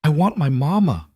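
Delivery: high, tearful plea; voice breaking. A narrator's reading. A little girl missing her mother, voice breaking. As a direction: let the voice crack on mama. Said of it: "her voice broke".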